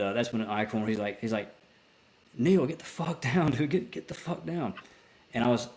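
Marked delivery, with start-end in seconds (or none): 2.4-4.7 half-shouted warning to duck; urgent warning. Retold or imitated. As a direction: driver urging gunner to get down under fire. Driver yelling warning under fire.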